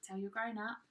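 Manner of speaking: ordinary delivery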